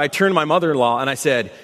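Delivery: normal talking voice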